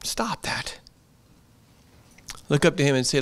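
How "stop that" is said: hushed, breathy rebuke; whispered scold. Real, not acted. Preacher hushes the congregation's angry worrying, whispered. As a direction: whisper it like a sharp secret.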